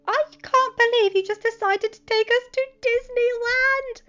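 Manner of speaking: very high, loud, slow amazed gush; bursting with disbelief; excited Peter. A narrator's reading. Peter in the car to Tony, high amazed gush.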